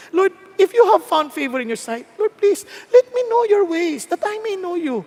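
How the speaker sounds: high, urgent prayer; acted heartfelt appeal, pitched high; earnest pleading. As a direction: plead with God to be known.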